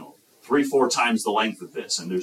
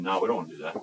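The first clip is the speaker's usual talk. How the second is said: lower, softer dismissive reply; sulky refusal; 4 semitones lower, 7 dB quieter, much faster than usual. Acted. Sitting kids refusing to dance, low and sulky. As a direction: turn it down without any interest. Sullen kids turning down playmates.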